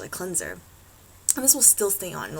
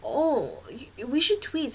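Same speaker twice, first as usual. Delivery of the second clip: higher, louder, drawn-out suggestion; imitated salon lady; 6 semitones higher, 5 dB louder, slower than usual. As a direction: play the salon lady gently pushing her idea. Voices the salon lady's suggestion.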